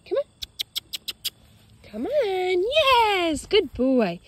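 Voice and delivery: bright, high-pitched coaxing for a dog; high dog praise. Real, not acted. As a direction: cheer the dog back to your side.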